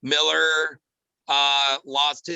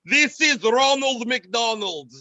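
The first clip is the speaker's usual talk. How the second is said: slow, loud, high mock-Russian accent; hammed-up Russian accent, drawn out; 7 semitones higher, 6 dB louder, slower than usual. Host mocks his impressions all coming out Russian, thick Russian accent. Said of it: "it just sounds Russian".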